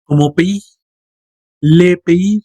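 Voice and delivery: "Le pays" said as "le pé-i", silent S; "pays" in two light vowels, no final S; French pays. Shown as a demonstration. Teacher repeating the model "le pays". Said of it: "Yo les sugiero pronunciar esto como pays".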